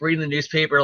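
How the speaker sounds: ordinary talk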